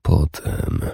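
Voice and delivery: whisper. A narrator's reading. Warrior whispering to Urdis to wait. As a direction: hush it under the breath. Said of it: "szepnął".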